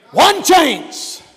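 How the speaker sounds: high, punched preacher's shout; shouted warning. Real, not acted. Preacher warning of no second chance, shouting. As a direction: cry out ONE CHANCE.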